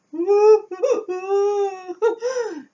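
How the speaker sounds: high, loud, tearful laughing cry; loud crying wail. Real, not acted. Fearing her cat is dead, she lets out a loud teary wail. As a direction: let out a teary half laugh, half sob.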